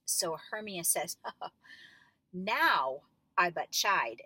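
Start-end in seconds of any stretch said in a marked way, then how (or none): 2.3-4.2 "Now" leaned on, then slow and deliberate; stress on now. Shown as a demonstration. Punch "Now", scolding. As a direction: coach demonstrating a scolding line, "Now" stressed. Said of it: "the emphasis needs to be now".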